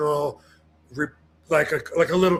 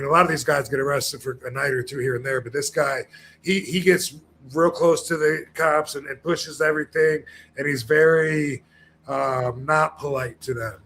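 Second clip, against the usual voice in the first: ordinary delivery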